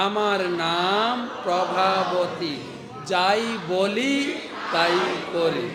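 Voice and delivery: slow, loud, defiant self-introduction; fiery gopi's boast. Shown as a demonstration. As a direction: lean into every word like a proud fierce woman. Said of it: "এবার এইটা মুখ".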